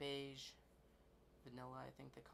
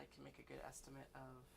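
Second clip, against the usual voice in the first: ordinary delivery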